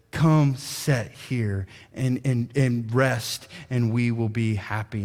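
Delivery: slow, soft, gentle offer of rest; tender invitation. Acted. Old tree trunk inviting the tired boy to rest, tenderly. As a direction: invite him kindly to sit, very slowly. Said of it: "straightens up as best as it can and says".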